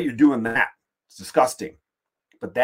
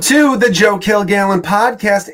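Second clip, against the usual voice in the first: big, loud, slow show-opening call; announcer intro; 9 semitones higher, 12 dB louder than usual. Acted. Host greeting his listeners in a loud announcer call. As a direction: boom it out like a radio host.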